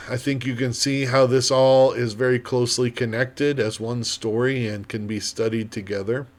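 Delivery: ordinary talk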